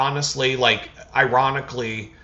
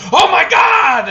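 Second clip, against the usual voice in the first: very high, loud, slow scandalised shout; Mister Wonderful shocked; 9 dB louder, slower than usual. Pretend. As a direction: belt it like a stunned TV investor. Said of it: "Mister Wonderful's gonna be like".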